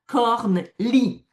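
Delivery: "corne, lit" as two separate syllables; syllables inverted. Shown as a demonstration. Player doing the inversion task to keep cards, "corne, lit" split slowly. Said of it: "pour pouvoir inverser".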